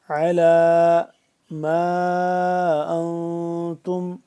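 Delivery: formal Quranic recitation. Shown as a demonstration. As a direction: recite with full tajweed, drawn out. Recites verse slowly.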